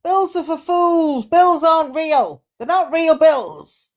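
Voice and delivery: high, incredulous comic rant; hammed-up indignant Aussie guest; ranting Karen. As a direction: pitch it up in mock outrage. Playing Karen, ranting about bills.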